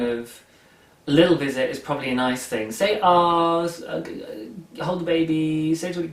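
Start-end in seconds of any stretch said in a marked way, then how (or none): none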